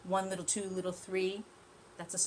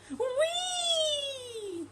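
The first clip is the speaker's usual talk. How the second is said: very high, loud squeal of glee; joyful whee; 5 dB louder than usual. Acted. Tortoise flying off the bump, high squeal. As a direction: squeal like sliding through the air. Tortoise flies through air.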